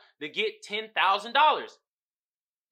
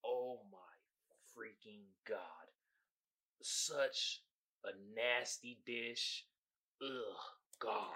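softer, low disgusted groan; quiet, low revolted complaint; 9 semitones lower, 13 dB quieter, slower than usual. Real, not acted. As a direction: sound sickened by the dish. Disgust at the dish.

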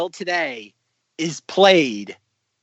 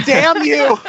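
high, loud mock curse; hammed-up outburst; 11 semitones higher, 6 dB louder than usual. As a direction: hurl the curse melodramatically. Wife's curse, repeated as an outburst.

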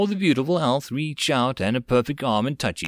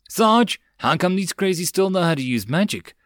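higher-pitched call to the sergeant; 6 semitones higher than usual. A narrator's reading. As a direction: call out a baffled question. Marine calls to sergeant under fire.

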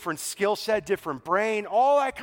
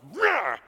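short, higher-pitched "rah" outburst; mock roar; 5 semitones higher, 3 dB louder than usual. Preacher mimics Paul losing his temper, mock roar. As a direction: imitate someone snapping with a growly roar. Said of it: "Paul just went".

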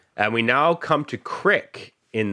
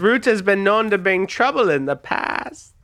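crime boss; 13 semitones higher than usual. Acted. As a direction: play a smug gang leader. Rufio greets Travis.

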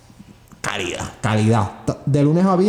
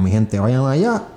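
ordinary talk

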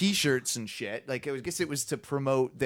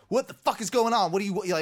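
higher, startled questioning; retold alarm; 7 semitones higher, faster than usual. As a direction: demand answers in a jolt of alarm. Retelling being startled on porch.

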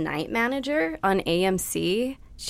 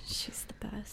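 whisper; 19 dB quieter, much slower than usual. Real, not acted. Fond aside.

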